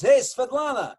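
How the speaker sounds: high, loud call-out; Russian relative impression. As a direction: hail her as the brash émigré relative. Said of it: "And then he goes".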